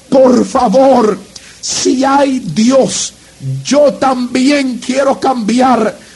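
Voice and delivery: slow, drawn-out plea, each word stretched; slow pleading prayer. Retold or imitated. A young man at the altar pleads with God, slow and drawn out. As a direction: beg God slowly, words dragged out.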